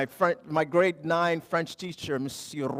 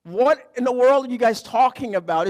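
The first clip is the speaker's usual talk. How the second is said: higher, louder, bewildered; baffled outburst; 7 semitones higher, 8 dB louder than usual. Retold or imitated. Immigrant husband lost at a French family dinner, baffled. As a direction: throw up your hands, lost at the table. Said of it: "I'm like".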